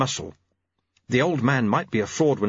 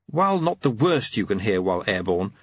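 ordinary delivery

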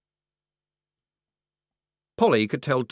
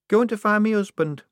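put-on higher, louder girlish admission; Shufti, timid; 12 semitones higher, 5 dB louder than usual. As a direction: blurt it out shyly. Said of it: "only a little bit louder".

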